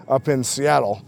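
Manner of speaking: ordinary talk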